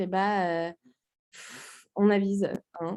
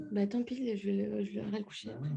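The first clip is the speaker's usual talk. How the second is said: whispering over a crib; 9 dB quieter, faster than usual. Real, not acted. Aside with the baby near.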